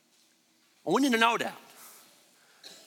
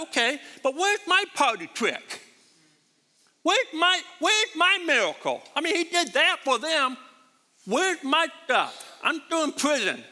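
raised, pleading voice demanding his own miracle; complaining John the Baptist; 6 semitones higher than usual. Retold or imitated. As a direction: whine up high like a forgotten prisoner asking where his share is. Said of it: "John the Baptist's like".

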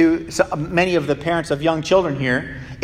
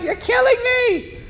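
put-on high, wailing child's cry; screaming child. Preacher imitating a child screaming at the parent, high wail. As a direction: wail it like a kid in agony. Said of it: "They're like".

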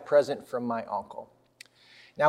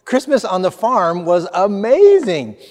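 excited; 5 semitones higher, 7 dB louder than usual. Retold or imitated. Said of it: "I was so ex".

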